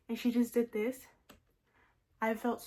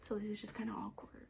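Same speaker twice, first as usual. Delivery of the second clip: soft unvoiced whisper; hushed so mom won't hear; whispering on the phone; 3 semitones lower, 9 dB quieter than usual. Real, not acted. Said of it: "Now I feel like I have to whisper".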